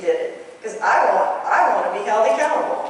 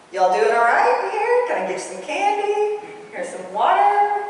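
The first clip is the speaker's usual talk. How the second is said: high, sugary Southern sing-song with a smile; sweet Southern accent; 11 semitones higher than usual. Retold or imitated. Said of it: "with this accent and a smile".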